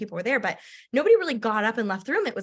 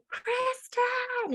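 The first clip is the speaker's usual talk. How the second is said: calling name. Retold or imitated. Upline calling across the room.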